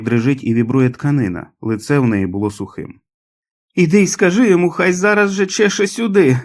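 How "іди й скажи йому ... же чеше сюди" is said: high, loud shouted order; sick mother's shrill yell; shouting. A narrator's reading. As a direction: cry it out high and demanding. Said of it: "закричала вона".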